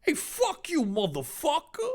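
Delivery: high, rowdy mock yell of a lout; mock crude pleb. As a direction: play a rude commoner for laughs. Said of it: "I'll stress how the plebs do it".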